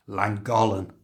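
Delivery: Llangollen with an ordinary English l; Llangollen, simple l at the start; plain L sound. Shown as a demonstration. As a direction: Llangollen made easy with a normal l. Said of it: "fine just to use a normal L sound".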